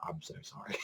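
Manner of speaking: breathy whispered apology, barely audible. Retold or imitated. Character apologizes to Hijikata, near-silent whisper. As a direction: whisper the apology through stifled laughter. Mock apology at episode end.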